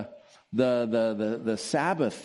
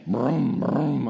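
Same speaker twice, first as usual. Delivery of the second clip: louder mock engine rumble, brum brum; motorcycle engine imitation; 4 semitones higher, 4 dB louder than usual. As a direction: rev it like a motorcycle coming down the driveway. Imitating dad's motorcycle arriving.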